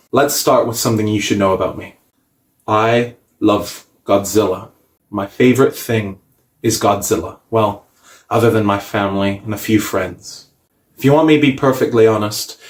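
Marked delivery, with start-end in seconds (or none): none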